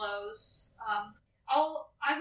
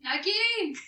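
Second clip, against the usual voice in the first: calling out; 6 semitones higher, 5 dB louder than usual. Real, not acted. Calling out to a teammate.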